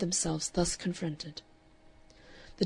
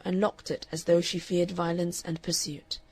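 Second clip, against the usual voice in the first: plain reading voice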